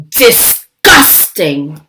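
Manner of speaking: slow, low disgusted drawl. Acted. Lily sneering at the praised baby, slow and disgusted. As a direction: draw it out in disgust. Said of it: "said Lily".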